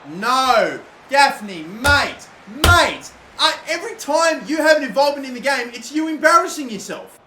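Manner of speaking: loud, high yelling of frustration; real exasperated scream; furious shout. Real, not acted. Gamer screaming at his awful striker, furious and loud.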